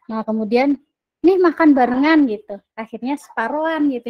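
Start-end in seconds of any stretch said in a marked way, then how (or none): 1.2-2.3 friend offering food. Retold or imitated. Friend shares his breakfast.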